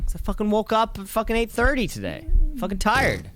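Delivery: high-pitched grumble about being tired; complain, pitched well up; tired complaint. Real, not acted. Co-host explaining an early wake-up, high-pitched complaint.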